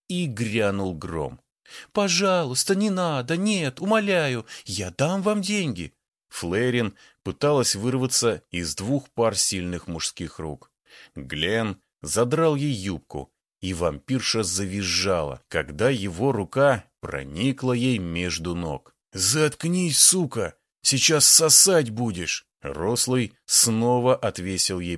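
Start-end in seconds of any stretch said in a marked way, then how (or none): 1.9-5.9 high desperate pleading. A narrator's reading. Woman begging attackers. 19.1-22.5 loud brutal shout; angry shout. A narrator's reading. Thug shouting at victim.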